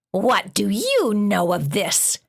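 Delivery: slow, stern, scratchy monster voice. A narrator's reading. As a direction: press her coldly like a scratchy-voiced beast. Monster interrogating the dog.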